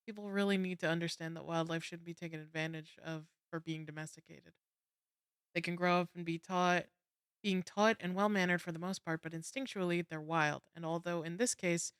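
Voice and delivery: quick, quiet reading voice; reading aloud. Acted. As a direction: read the article off the screen. Reads an article aloud.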